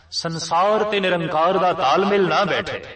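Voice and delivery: fervent preacher's climax; emphatic preaching. Acted. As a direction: raise it on ਸੰਸਾਰ and ਨਿਰੰਕਾਰ. Sermon parallel, second take raised.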